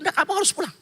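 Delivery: lightly voiced insistent caller; a hint of a firm voice on the phone; quoted caller.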